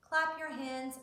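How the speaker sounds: ordinary delivery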